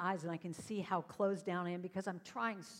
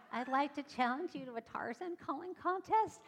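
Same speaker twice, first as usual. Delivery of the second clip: put-on higher-pitched kid issuing a challenge; young challenger; 6 semitones higher, 3 dB louder than usual. Girl challenging her idol from the audience, young challenger. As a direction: put on a high, eager young voice.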